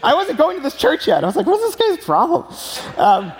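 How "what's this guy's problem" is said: incredulous. Retold or imitated. Inner reaction to the preacher.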